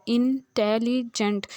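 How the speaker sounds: Intelligent drawn out slowly so its three syllables are heard; in-ten-li-gent, slowly broken into syllables. Shown as a demonstration. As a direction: stretch intelligent out for the class. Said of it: "तीन उसके आ रहे हैं ना syllabus".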